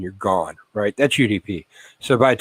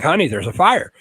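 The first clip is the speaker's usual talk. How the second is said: higher, pushing call to wake her; insistent repeat; 7 semitones higher than usual. Retold or imitated. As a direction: say it again, trying to get through. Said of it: "I'm like".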